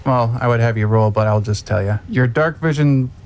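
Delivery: usual speaking voice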